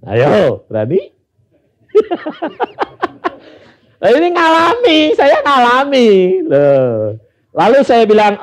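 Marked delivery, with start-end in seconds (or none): 1.9-3.3 rising high laugh. Real, not acted. Laughs at the story.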